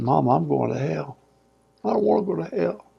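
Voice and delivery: lightly acted, quiet, rising distress; retold tearful plea. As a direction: imitate a crying boy pleading with his mother. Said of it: "and I'm crying".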